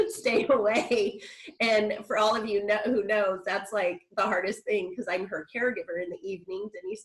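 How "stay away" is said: 1 imitating mom. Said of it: "my mom's telling me".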